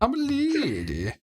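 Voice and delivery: high, slow falsetto lady. Acted. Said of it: "It's my lady voice".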